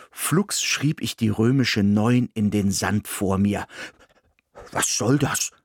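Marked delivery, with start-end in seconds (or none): 4.7-5.5 lightly voiced talking dog, puzzled; a hint of a dog's voice, asking what this is; dog Ismir puzzled by the drawing. A narrator's reading. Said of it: "fragte Ismir".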